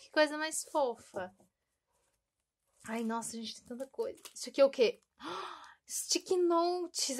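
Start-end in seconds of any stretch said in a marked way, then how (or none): 5.2-7.2 gasp, then slow, higher "sticky notes"; delighted gasp. Real, not acted. Finding sweet-themed sticky notes, a gasp of delight. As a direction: gasp and savour the find.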